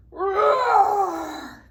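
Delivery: big loud roaring lion voice; mighty lion roar. Pretend. Librarian roars like the lion for the children. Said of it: "he made a mighty roar".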